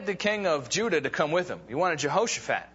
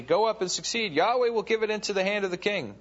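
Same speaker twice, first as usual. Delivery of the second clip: raised, mock-encouraging reading; sarcastic retort; 6 semitones higher than usual. A narrator's reading. Micaiah answering the kings sarcastically, higher mock cheer. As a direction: let the fake blessing drip with irony. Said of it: "retorted sarcastically".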